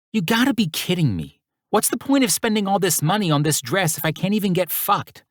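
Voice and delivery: put-on high, indignant Brooklyn accent. A narrator's reading. Mirabella grumbling at Axel in Brooklyn accent. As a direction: complain in a thick Brooklyn accent. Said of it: "her Brooklyn accent stronger than ever".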